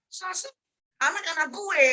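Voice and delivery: very high mock disbelief; indignant parent. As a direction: protest like a parent too proud to apologise. Acting a parent refusing to apologise. (orang tua tak terima, nada tinggi dibuat-buat)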